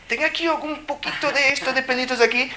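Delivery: high mock request. Mocks asking someone to shave.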